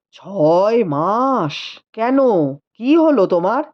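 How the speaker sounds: slow, low disbelieving question; stunned husband questioning; mild surprise. Acted. Husband hearing of six months, mildly surprised. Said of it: "husband surprised".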